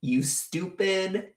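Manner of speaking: slow, drawn-out mock tirade at idiots; imitated telling off. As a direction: spell out each insult slowly. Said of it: "That's what I wanted to say".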